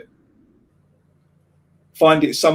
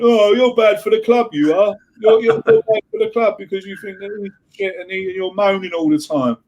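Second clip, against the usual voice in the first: mocking scold; 5 semitones higher than usual.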